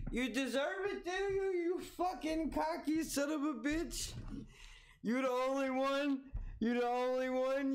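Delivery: mock him in a squeaky voice; high mocking voice. Streamer mocking the guest's claim he deserved it in a squeaky jeer.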